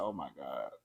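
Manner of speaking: low, quiet oh my God; shocked murmur. Retold or imitated. Watching Brittany's British accent video, low stunned murmur. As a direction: let it fall out low in shock. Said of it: "I said".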